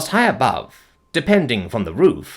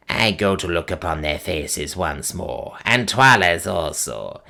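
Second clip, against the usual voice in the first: put-on piping old witch; 4 semitones lower than usual. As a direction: croak it out like an ancient witch. Gagool boasts of kings.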